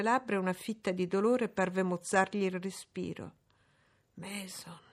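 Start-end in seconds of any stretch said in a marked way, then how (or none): 4.1-4.8 breathless whisper of a shaken man; stunned whisper. A narrator's reading. Rochester struck, whispering the name to Jane. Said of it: "scandì le sillabe tre volte".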